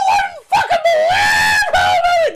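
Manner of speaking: high, strained yelling voice; frustrated shout. Pretend. Voicing Billy answering.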